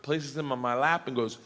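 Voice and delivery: usual speaking voice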